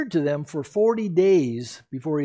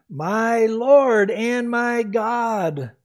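slow, awed and sincere, each word weighed; reverent declaration; 5 semitones higher, 4 dB louder, much slower than usual. Acted. Thomas confessing to the risen Jesus, slow and reverent. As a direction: speak it as a solemn confession of faith. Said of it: "that is a statement of faith and belief".

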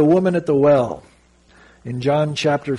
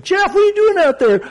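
high, loud call across the water; best friend calling Jeff from out in the surf; 5 dB louder than usual. Retold or imitated. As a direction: friend shouting from the waves. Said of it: "And he finally is like".

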